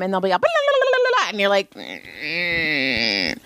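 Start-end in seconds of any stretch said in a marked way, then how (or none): 0.4-1.3 high, rapid-fire nonsense syllables; mock an impossible name with high babble; gibberish imitation. Mimicking an unintelligible spelled-out name. 1.7-3.4 low, drawn-out puzzled mmm; baffled hum. Retold or imitated. Acting out baffled reaction to name.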